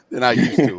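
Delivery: laughing through the words. Real, not acted. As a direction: chuckle out the end. Laughing at own joke.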